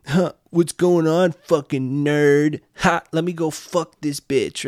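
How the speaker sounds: dumb jock voice. Retold or imitated. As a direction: sneer like a bully jock. Jock voiced mocking nerd.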